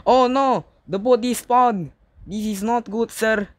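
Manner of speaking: high, loud panicked cries; scared horror game streamer. Real, not acted. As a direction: yell for help as it bites. Shark eating player, calls for help.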